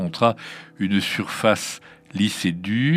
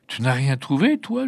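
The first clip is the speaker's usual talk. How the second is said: put-on lightly raised, polite child's question; 5 semitones higher than usual. A narrator's reading. Blonde child asks empty-handed Antonella, polite question. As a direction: ask politely, like a little girl. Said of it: "avec politesse".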